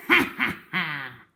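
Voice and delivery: villainous ha ha ha; crocodile's loud laugh. A narrator's reading. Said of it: "laughed out loud".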